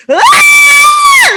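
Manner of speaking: loud high wordless scream, all out; release scream. Retold or imitated. Mom reenacting her scream alongside her screaming kids, loud and high. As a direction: let it rip, a cathartic scream. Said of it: "it was just this scream".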